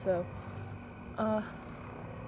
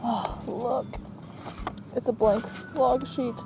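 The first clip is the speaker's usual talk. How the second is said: usual speaking voice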